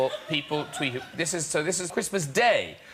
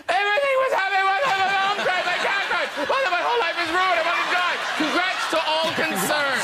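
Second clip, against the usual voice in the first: very high, frantic mock hysterics; hammed-up meltdown, pitched way up; insane fan rant; 11 semitones higher than usual. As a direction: wail it out as if life is over. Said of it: "this is just insane".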